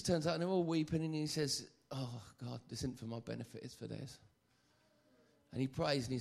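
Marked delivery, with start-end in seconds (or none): 1.9-4.2 low, soft acted aside to God; quiet, understated imitation of praying; hushed aside prayer. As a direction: drop the voice as if confiding in prayer. Acts out Jesus praying aside.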